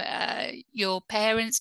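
ordinary talk, nothing marked

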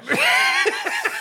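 very high giggling burst; high laugh. Real, not acted. Co-host amused by his friend's answer, high laugh. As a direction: let out a quick real giggle.

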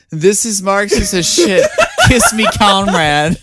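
laughing, high mock drama; mock quote. Teasing a friend with her own quote, laughing high. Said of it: "I think your exact quote was".

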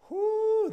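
a sudden high whoo from the chill; whoo from cold water. Retold or imitated. Remembering the freezing pool water, high mock yelp. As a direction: yelp like icy water just hit you.

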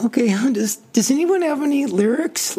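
high mock-confused plea; mock clueless singer. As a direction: play the flustered newbie looking for lyrics. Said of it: "you don't look around like".

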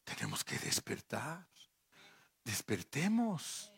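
hushed whisper, urgent. Acted. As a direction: breathe it to the congregation. Urgent quiet call to wake.

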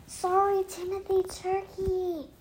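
soft, distant apology through a backpack; muffled from outside. Acted. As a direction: call in from outside the bag. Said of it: "Lisa's voice echoed from outside".

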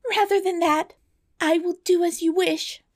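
very high storybook maiden; desperate princess. A narrator's reading. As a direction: give in, in a high pleading voice. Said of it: "she cried, turning to the dwarf".

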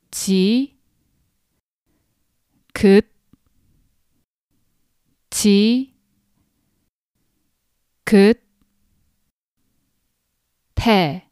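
지 긋 지 긋 태 said one syllable at a time with long pauses; each syllable on its own, spaced apart. Shown as a demonstration. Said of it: "syllable by syllable".

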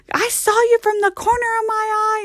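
high-pitched imitation of a triumphant spotter; dad calling out. Daughter mimics dad catching an actor, calling out accusingly. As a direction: call out the hidden actor as Pop would. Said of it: "he, like, calls them out".

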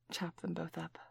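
soft whispered instruction. Acted. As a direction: whisper it close. Aside while cooking.

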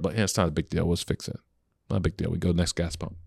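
soft, low, easygoing reassurance; calm shrug. Shown as a demonstration. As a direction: show how relaxed it sounds. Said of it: "You start".

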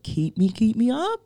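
ordinary delivery

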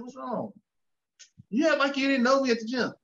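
husband caught off guard by his wife's complaint, high and startled; retold disbelief. Retold argument with wife.

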